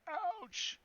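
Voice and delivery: high-pitched, drawn-out cry of pain; acted ouch. Game master voices the guy hit in the chest, high mock ouch. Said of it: "He's like".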